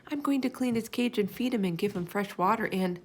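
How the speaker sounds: ordinary delivery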